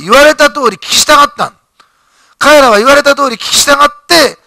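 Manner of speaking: 熱く高まる説教の声で; preaching at full force. Acted. Preacher builds to climax. (力強く盛り上げる説教)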